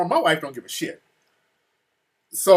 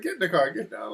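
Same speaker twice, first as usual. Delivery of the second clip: higher, softer hurried order; retold hushed urging; 4 semitones higher, 5 dB quieter than usual. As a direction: urge her along, keeping it down. Husband hurrying loud wife into car.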